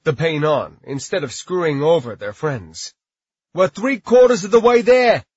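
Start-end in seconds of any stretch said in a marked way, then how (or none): 3.5-5.2 loud, high encouraging yell. A narrator's reading. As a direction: yell the good news to everyone. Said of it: "Josh yelled".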